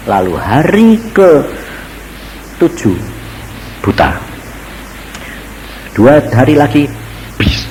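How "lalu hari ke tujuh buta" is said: ordinary talk, nothing marked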